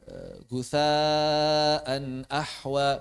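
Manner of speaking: "gusaan ahwa" chanted very slowly with long vowels; drawn-out recitation. Shown as a demonstration. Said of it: "ada beberapa contoh kalimat". ("gusaan ahwa" dilantunkan sangat lambat)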